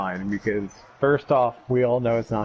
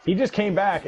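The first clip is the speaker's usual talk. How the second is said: ordinary delivery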